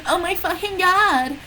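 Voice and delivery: high, put-on shocked voice; lightly hammed-up horror; mock cry. A narrator's reading. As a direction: shriek it in horror. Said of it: "I cried".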